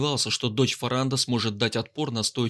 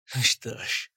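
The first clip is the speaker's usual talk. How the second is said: gloating smirk. Acted. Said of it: "злорадно ухмыльнулся солдат".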